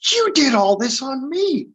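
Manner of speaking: high, drawn-out mock realisation; imitated astonished client. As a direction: act out someone realising they've been worked on.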